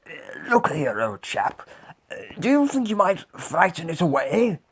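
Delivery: animated lion, lifting into the question; lion character. A narrator's reading. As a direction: coax the creature as a storybook lion. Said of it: "said the Lion".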